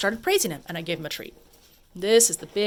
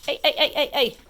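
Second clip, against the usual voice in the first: interrupting call; 7 semitones higher than usual. Real, not acted. Interrupting dog's play.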